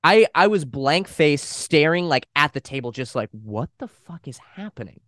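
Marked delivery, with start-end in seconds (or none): 3.3-5.0 much softer, lost in shock; stunned mutter. Retold or imitated. Son retelling his shock at expulsion options, softly. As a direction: mutter it like you're frozen. Blank face staring in shock.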